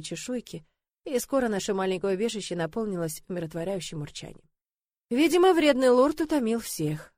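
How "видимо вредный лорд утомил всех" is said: plain reading voice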